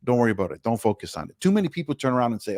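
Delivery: ordinary talk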